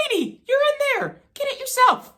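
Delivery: high, sassy pretend comeback; imagined sassy retort. Son imagines talking back to mom, high and sassy. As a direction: play the retort you'd never dare say. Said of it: "I'm not gonna tell her that".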